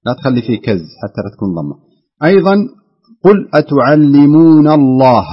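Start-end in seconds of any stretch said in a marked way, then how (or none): 3.5-5.3 الله with a wide open "ā", loud and drawn out; open vowel in Allah. Shown as a demonstration. Teacher modelling for student, slow open الله. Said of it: "افتح الفم".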